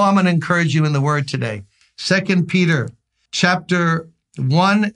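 usual speaking voice

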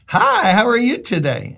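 a touch of a sunny shopper greeting a stranger; cheery stranger's greeting. Retold or imitated. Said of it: "she just looks at me and goes".

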